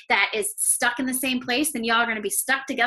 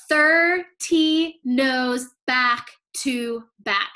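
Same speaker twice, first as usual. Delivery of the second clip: very slow, each word weighed; slow emphatic; 3 dB louder, much slower than usual. Shown as a demonstration. Coach stressing the sister's thirty rejections, slowly. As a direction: drag out every word for effect. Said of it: "emphatic number".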